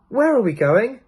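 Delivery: put-on a boy calling after the old man; Peter. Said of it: "said Peter".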